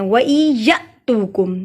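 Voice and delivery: slow वई या तूकुम with तू drawn long; lengthened तू. Shown as a demonstration. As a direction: hold तू long after a jerked या. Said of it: "तू को लंबा करेंगे".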